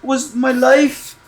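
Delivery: hammed-up confusion, voice jumping up high; mock astonished realisation; shocked disbelief. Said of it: "I'd be like".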